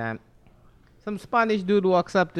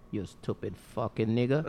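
low, soft muttered thought; deadpan mock thought, dropped low; imagined silent insult; 10 semitones lower, 7 dB quieter than usual. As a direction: mutter it low as someone else's private verdict. Voices the customer's imagined thought.

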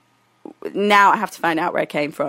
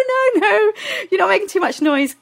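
awkward reassurance; 12 semitones higher than usual. Retold or imitated. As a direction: re-enact a flustered, high-pitched denial. Naked, hastily reassuring stranger.

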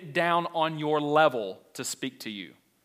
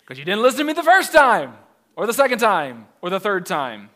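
annoyed mom; 4 semitones higher, 7 dB louder than usual. Retold or imitated. Mother snapping back.

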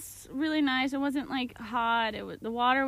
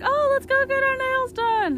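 high, loud, excited girlfriend imitation; eager suggestion; 12 semitones higher, 7 dB louder than usual. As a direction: mimic a friend's gushing, high-pitched enthusiasm. Acting friend's eager suggestion.

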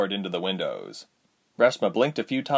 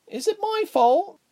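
usual speaking voice

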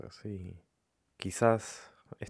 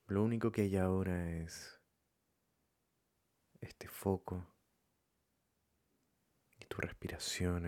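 calm, softened meditation voice with long pauses; guided meditation; 4 dB quieter than usual. Acted. Leading listeners to follow their breathing, calm meditation voice. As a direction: guide them gently, leaving room between phrases.

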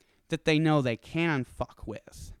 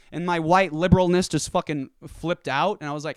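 ordinary talk, nothing marked

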